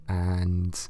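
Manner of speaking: ordinary talk, nothing marked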